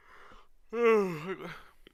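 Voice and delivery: big, high, loud sigh; loud exasperated sigh. Real, not acted. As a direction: heave a fed-up sigh. Exasperated over a bad pick.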